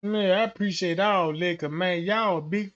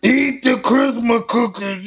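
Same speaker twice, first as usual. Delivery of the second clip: aggressive demand; 5 dB louder than usual. Retold or imitated. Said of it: "they talking aggressive now".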